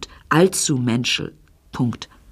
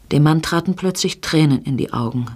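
usual speaking voice

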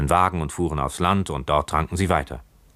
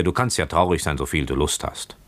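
usual speaking voice

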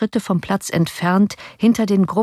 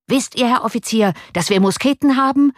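rising, higher mock-angry challenge; furious call; 7 semitones higher than usual. A narrator's reading. Citizen commander threatening the officer, higher and heated. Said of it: "rief voller Wut".

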